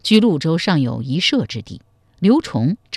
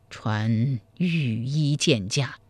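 slow, labored, softer command; out of breath; 4 semitones lower, 7 dB quieter, slower than usual. A narrator's reading. Ailing emperor summoning the imperial physician, weak and winded. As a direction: drag the words out as if short of breath. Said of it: "气喘吁吁地说".

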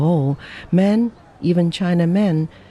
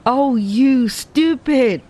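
slow, higher exasperated jab; exasperated exclamation; 8 semitones higher, slower than usual. A narrator's reading. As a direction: drag it out in mock exasperation. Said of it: "exclaimed the girl".

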